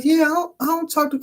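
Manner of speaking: imitating a high-pitched Southern woman; high mock Southern protest; Southern accent. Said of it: "she had that Southern accent".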